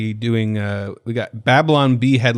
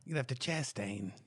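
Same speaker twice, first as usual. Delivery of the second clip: Boston accent slipping into Australian; soft, slow attempt at a Boston accent; failed Boston accent; 18 dB quieter, slower than usual. Shown as a demonstration. Host trying a Boston guy, accent drifting Australian. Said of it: "Oh, that's Australian".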